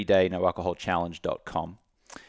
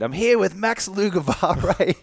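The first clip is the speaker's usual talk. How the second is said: hammed-up intro; 11 semitones higher, 5 dB louder, slower than usual. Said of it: "And I said".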